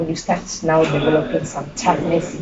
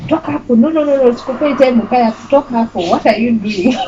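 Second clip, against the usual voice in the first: higher, brisk mock scolding; mock shooing; 6 semitones higher, faster than usual. Grandfather shooing granddaughter away from grave, mock scolding. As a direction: shoo her off the grave in jest.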